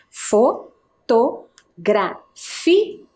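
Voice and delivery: "Fotografia" very slowly, a gap after each syllable. Shown as a demonstration. Teacher counts syllables with pupils, syllable by syllable. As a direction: count out "Fotografia" in separate pieces. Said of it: "Vamos contar?".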